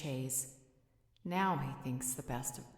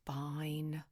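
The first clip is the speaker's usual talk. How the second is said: grudging surrender. Acted. Mate gives in to the other's call, grudging sigh. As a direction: sigh out your reluctant agreement. Gives in after losing argument.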